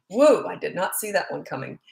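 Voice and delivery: usual speaking voice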